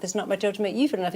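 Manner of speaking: fast rattled-off repeat; tossed off quickly, stressing "you". Shown as a demonstration. Telling the host, a fast emphatic repeat.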